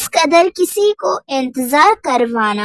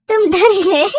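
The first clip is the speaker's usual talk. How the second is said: higher, louder singsong taunt; mocking tease; 5 semitones higher, 5 dB louder than usual. [name] mocks Dev for being scared, teasing singsong. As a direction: poke fun at his fright.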